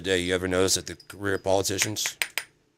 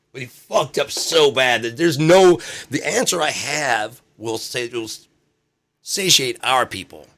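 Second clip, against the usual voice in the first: agitated rant; 6 semitones higher, 5 dB louder than usual. Retold or imitated. Politician ranting.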